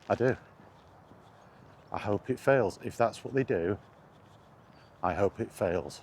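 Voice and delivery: usual speaking voice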